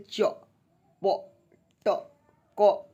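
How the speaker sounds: च, प, ट, क cut short without अ; short च, प, ट, क with the vowel dropped; half consonants. Shown as a demonstration. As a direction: clip each of च, प, ट, क separately. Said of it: "ऐसे करके उच्चारण करके पढ़ते हैं".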